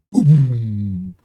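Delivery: loud, resonant bass boom with a long hum; imitated bass thump, ringing on; booming subwoofer imitation. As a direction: burst out like a car-shaking subwoofer. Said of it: "the whole car just kinda shakes".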